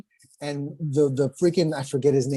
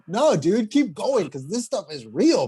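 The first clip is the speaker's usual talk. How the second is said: excited retelling; 6 semitones higher, slower than usual. Retold or imitated. Director's reply retold excitedly.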